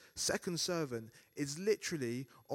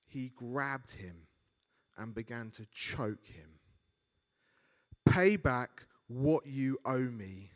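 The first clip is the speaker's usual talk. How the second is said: scripture reading; 4 dB louder than usual. Acted. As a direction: read the passage with gravity. Reads the Bible passage.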